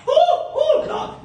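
a squealing ooh ooh, then Lord; high excited whoop. Acted. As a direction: throw up a high delighted cry. Preacher exclaims over holiday panic.

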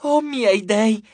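high, drawn-out fan squeal; stretched high nymphs' squeal. A narrator's reading. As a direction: shriek it in swooning delight. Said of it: "strillarono le ninfe".

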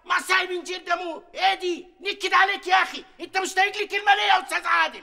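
exasperated merchant shouting at his partner; angry outburst. Acted. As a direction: yell at him in a huff. Merchant erupts at Adel in argument.

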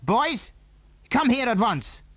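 shouted call. A narrator's reading. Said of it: "shouted into the night".

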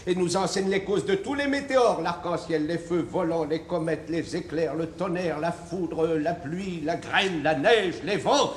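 pompous list with explosive finish; building recitation. Acted. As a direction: list steadily, then burst out on the last item. Philosopher reels off a swelling list.

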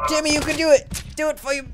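high, loud encouragement; urgent cheering. Pretend. Dad urging his son on, excited shout. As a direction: call out to urge him on.

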